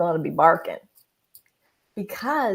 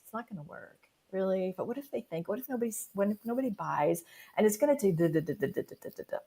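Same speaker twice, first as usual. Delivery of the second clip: much softer worried mutter; muttered doubts; 8 dB quieter than usual. Retold or imitated. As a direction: mumble the fears under your breath. Doubting inner voice imitated.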